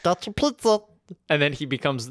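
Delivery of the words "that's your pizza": high, thin imitation voice; high mock character voice. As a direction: pitch up into a silly character. Imitating pizza driver character.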